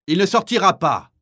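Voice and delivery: high, loud defiant cry; fierce defiance. Acted. Martin protecting the man without clogs, fierce shout. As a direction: stand up to him fiercely.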